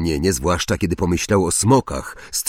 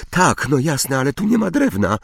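high, hurried, flustered cry; high-pitched outburst at moderate volume; 6 semitones higher than usual. A narrator's reading. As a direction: exclaim in rising panic, quickening. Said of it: "krzyknęła Hermiona".

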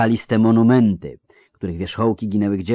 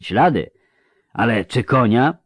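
slightly raised, doubting question; sceptical questioning; 5 semitones higher, 5 dB louder than usual. A narrator's reading. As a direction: query the claim, far less shrieked. Sceptical vampire questions the shouted claim. (powątpiewające pytanie)

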